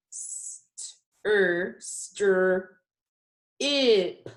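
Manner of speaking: "St-, er, stir, ip" in separate blended chunks; bare sounds with gaps, joining into "stir" then "ip"; sounding out. Shown as a demonstration. Teacher checking her spelling, sounding out piece by piece. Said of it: "put these together".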